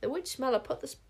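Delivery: ordinary delivery, nothing marked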